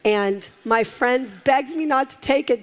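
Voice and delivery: ordinary talk, nothing marked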